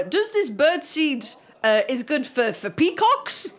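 hammed-up Polish accent, rising in pitch. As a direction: imitate a Polish customer asking earnestly. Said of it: "I love the Polish accent".

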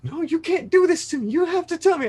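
a high, insistent mother begging to know; mother on the phone demanding the truth, high; imitating mom pleading. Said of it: "she was like".

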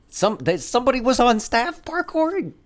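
high, loud exasperated rant. Real, not acted. Ranting about lazy CGI.

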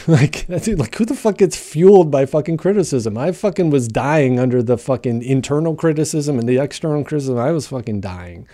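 blurting out how absurd it sounds; incredulous outburst. Real, not acted. Ranting to viewers about Kobe's quote, loud and incredulous.

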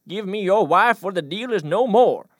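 high, very loud demanding yell; furious yell. Acted. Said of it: "Davis whipped around and yelled".

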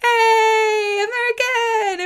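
high, drawn-out imitated cheer; mock calling out. Shop guys greeting the returning American, imitated calling out. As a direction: hail a returning customer in a high mock shout.

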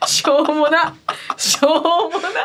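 helpless laughter, words stretched out; laughing exasperation. Real, not acted. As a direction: laugh through the words at how silly it all is. Cracking up at their silly talk.

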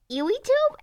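"Ewy tube" said as one puzzled word, pitch jumping high; misreading Ewy tube. Retold or imitated. Girl misreads the YouTube Red label, puzzled and high. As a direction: sound out "Ewy tube" like a kid reading the letters wrong. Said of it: "which I was like".

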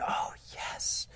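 a hushed, secretive yes; whispered reassurance. Acted. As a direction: breathe it out as a soft whisper. Priest gives the answer.

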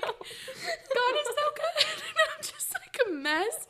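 high, slow, tearful laughing; happy crying. Real, not acted. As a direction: overcome with joy, crying. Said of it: "I'm just looking at everyone ... like".

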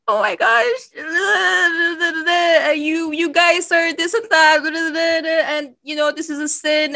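high, loud wailing imitation of a crying friend; hammed-up crying voice; mock sobbing. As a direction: mimic a tearful, scolding rant in a high pitch. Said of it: "she starts crying, she starts sobbing".

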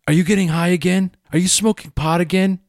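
high, fast suspicious mother; accusing mom. Retold or imitated. Mom accusing her teen of getting high, sharp and fast.

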